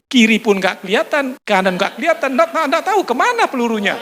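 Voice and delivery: ordinary delivery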